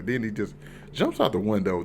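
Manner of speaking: normal talking voice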